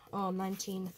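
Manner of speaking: normal talking voice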